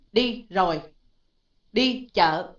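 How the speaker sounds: loud, separate Vietnamese syllables; Vietnamese single tones. Shown as a demonstration. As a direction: give each word of "đi chợ" its own single tone. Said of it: "nó không có giống như tiếng Việt".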